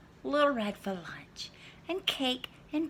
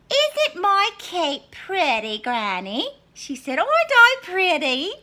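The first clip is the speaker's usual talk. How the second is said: put-on preen like a vain little girl; Little Red; 11 semitones higher, 10 dB louder than usual. Little Red showing off her cape to Granny, high.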